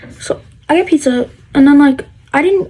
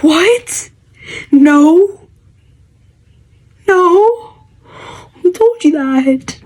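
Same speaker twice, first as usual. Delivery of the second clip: shocked denial; 5 semitones higher, much slower than usual. Retold or imitated. Teen denying rumor to classmates, shocked and high. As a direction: deny it in disbelief. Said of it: "And I'm like".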